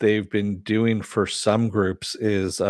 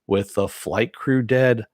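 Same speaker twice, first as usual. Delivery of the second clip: ordinary talk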